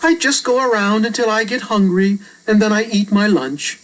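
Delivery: put-on a matter-of-fact young boy; a hint of a casual boy explaining; boy character voice. Reader voices Albert.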